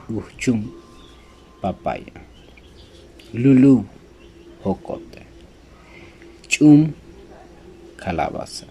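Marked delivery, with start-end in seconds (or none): 3.3-3.8 emphatic word. Shown as a demonstration. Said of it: "vamos a decir su pronunciación". 6.5-6.9 emphatic word. Shown as a demonstration. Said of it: "vamos a decir su pronunciación".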